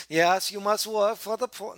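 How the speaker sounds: slow, heavy mock Russian accent. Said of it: "that sounds more Swedish than anything".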